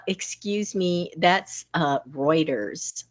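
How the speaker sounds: soft, slow, politely correcting; a quiet, polite correction; gently correcting a friend, quiet. Pretend. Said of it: "don't be afraid to say".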